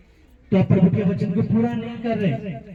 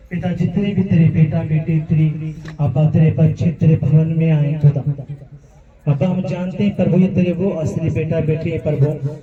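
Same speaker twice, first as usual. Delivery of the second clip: lower, fervent prayer voice; intercessory prayer; 5 semitones lower, 4 dB louder than usual. Real, not acted. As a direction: pray over the congregation to the Father. Closing prayer over people.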